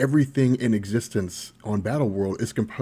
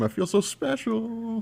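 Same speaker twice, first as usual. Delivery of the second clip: high, drawn-out mock delight; playfully over-sweet, stretched out; mock gushing; 11 semitones higher, 3 dB quieter, slower than usual. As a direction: gush it slowly, way up high. Playful gushing at caller waking up.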